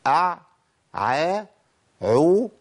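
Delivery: "أع، عا، عو" as isolated sounds with a clear ع; articulation demo. Shown as a demonstration. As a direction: sound out each ع syllable separately. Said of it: "يبقى إيه؟".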